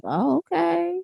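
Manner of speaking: high, soft intrigued murmur; intrigued reaction. Retold or imitated. Reacting to a Louisiana accent, softly intrigued. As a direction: go up high and soft, as if won over. Acts out being charmed by accent.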